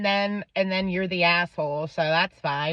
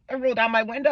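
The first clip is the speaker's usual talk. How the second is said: usual speaking voice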